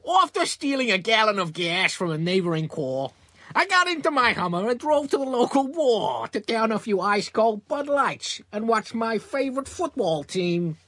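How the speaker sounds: put-on high-pitched, old-time American private-eye accent; bad American accent. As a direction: badly fake a nineteen-twenties American detective. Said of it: "for us to practice American accents with".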